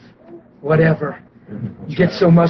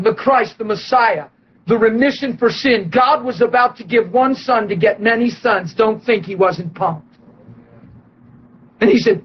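normal talking voice

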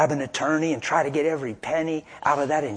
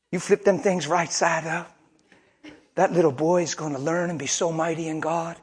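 normal talking voice

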